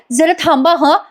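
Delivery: high, sing-song mimicking copy; mocking [name]'s copy. [name] copying Sayali's request, high mocking sing-song. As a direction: parrot the line in a high mocking lilt. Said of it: "ही काय drama करते बघा".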